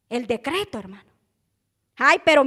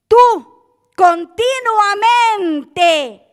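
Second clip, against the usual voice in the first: raised, emphatic pulpit delivery; forceful sermon cadence; full-force preaching; 3 semitones higher, 4 dB louder, slower than usual. Real, not acted. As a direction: stretch the key words, then drive on loudly.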